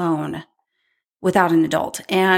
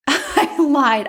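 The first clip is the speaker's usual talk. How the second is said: higher, amused repeat through a laugh; laughing confession; 6 semitones higher than usual. Real, not acted. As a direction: laugh as you own up to it.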